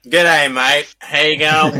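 hammed-up Australian accent, loud; mock Aussie game greeting; over-the-top Australian accent. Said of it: "an Australian voice setting where they have the accent".

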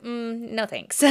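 soft, dismissive brush-off; dog's aloof refusal. Retold or imitated. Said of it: "And she's like".

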